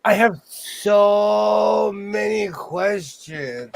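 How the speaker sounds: slow, amazed disbelief; incredulous drawn out. Real, not acted. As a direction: stretch every word in disbelief.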